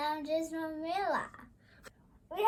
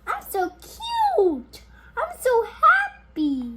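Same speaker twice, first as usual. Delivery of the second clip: high, slow, sweet toy voice; cute toy voice; 7 semitones higher, 7 dB louder, slower than usual. Pretend. A child voicing her toy to her mother, high and slow. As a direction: coo it like a tiny proud toy.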